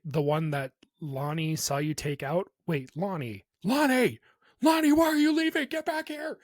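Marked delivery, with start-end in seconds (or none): 3.6-6.4 much higher and louder call. Real, not acted. Calling Lonnie back as he walks off, loud call. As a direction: shout after someone leaving. Calling after a leaving friend.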